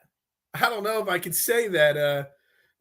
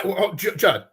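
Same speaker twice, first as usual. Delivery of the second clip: ordinary delivery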